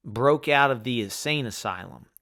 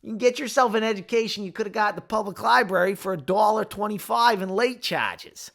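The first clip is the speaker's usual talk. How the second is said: bad Boston accent; 9 semitones higher than usual. Quoting Good Will Hunting in a bad Boston accent. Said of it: "That's such a bad Boston accent".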